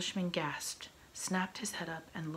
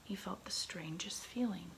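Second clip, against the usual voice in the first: usual speaking voice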